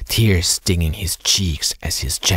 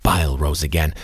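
ordinary delivery

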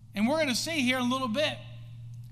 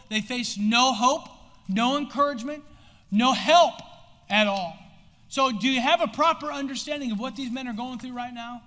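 ordinary delivery

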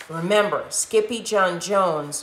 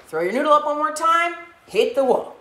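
excited call-out; 9 semitones higher, 3 dB louder, faster than usual. Pretend. Leading the class activity.

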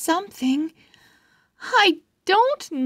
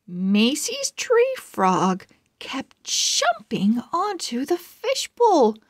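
ordinary delivery, nothing marked

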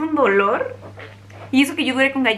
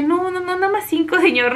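raised, pleading no no no; high pleading protest; 7 semitones higher than usual. Retold or imitated. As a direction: beg him to take back half. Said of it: "Y yo de". (ruego agudo y apenado)

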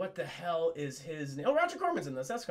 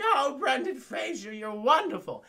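higher, slow, gushing old-lady imitation; doting granny; 5 semitones higher, 4 dB louder than usual. Granny cooing to Brendan Fraser, gushing mock voice. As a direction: fuss over him like a doting granny.

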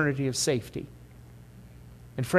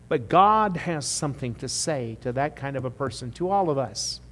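ordinary talk, nothing marked

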